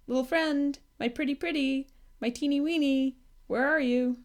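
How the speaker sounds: high, coaxing little voice; calling sweetly. A narrator's reading. Moomintroll calling his lost dragon, high coaxing voice. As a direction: coax a tiny pet out of hiding. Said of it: "calling the whole time".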